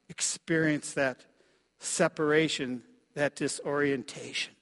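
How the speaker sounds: ordinary talk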